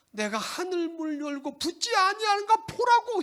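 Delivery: high, loud daring challenge; God's challenge. Acted. God daring the people to test him. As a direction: dare them to test him. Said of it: "이렇게 말하고 있다".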